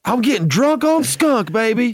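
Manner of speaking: high, loud party shout; excited high whoop. Acted. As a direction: belt it out like a party. Joking about getting high.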